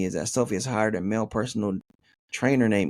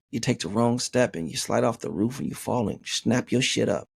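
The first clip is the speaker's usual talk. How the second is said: usual speaking voice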